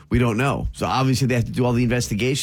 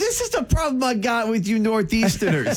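higher-pitched, exasperated mock tirade; mock scolding; 7 semitones higher than usual. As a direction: playfully lay into your co-host, pitched up. Mock tirade at Northeastern co-host.

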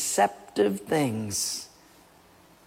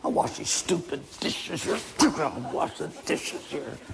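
softer, muttering under the breath; muttered grumbling; 6 dB quieter than usual. Acted. Husband grumbling to himself over the dishes, muttered. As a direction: grumble the chore to yourself.

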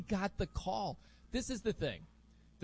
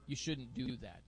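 low, soft telling-off; mild reproach; 6 semitones lower, 8 dB quieter than usual. Acted. Speaking to a kid taking advantage, mild soft reproach. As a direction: drop the voice for a gentle scolding. Mild word to the misbehaving child.

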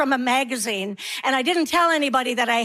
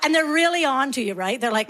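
usual speaking voice

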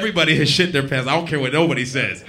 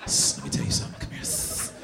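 unvoiced, softer conspiratorial whisper; whispering ass; 13 semitones lower, 5 dB quieter than usual. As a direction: whisper it like a secret. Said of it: "your ass is whispering".

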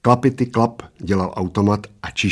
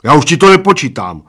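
loud, higher stubborn refusal; 6 semitones higher, 8 dB louder than usual. A narrator's reading. Bald man refuses to keep timing, stubbornly. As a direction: dig in and refuse. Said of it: "oznámil plešatec umíněně".

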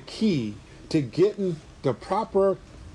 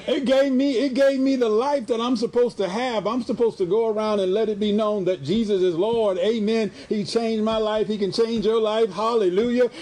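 higher, rising preacher fervor; fervent preaching; 4 semitones higher than usual. Real, not acted. Bishop stirring his congregation, fervent preaching. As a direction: lift them up with a joyful shout of praise.

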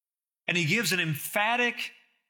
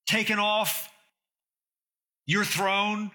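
raised, fervent pulpit appeal; earnest preaching; 3 semitones higher than usual. Acted. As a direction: press the question home to the congregation. Opening appeal of the sermon.

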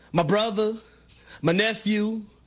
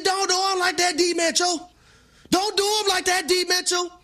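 retold yelling at TV; 9 semitones higher than usual.

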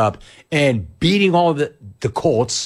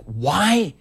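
high, pleading incredulous questions; baffled that a rich guy cares; retold outrage, voice pitched way up; exasperated disbelief; 8 semitones higher than usual. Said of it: "And I'm like".

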